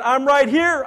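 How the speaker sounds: loud, high, emphatic answer; calling back. Acted. Preacher voicing God answering the desperate, calling back loudly. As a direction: call back firmly across a distance.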